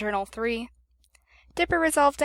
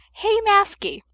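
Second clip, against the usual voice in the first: teasing pest; 7 semitones higher, slower than usual. A narrator's reading. Toby pestering Masky.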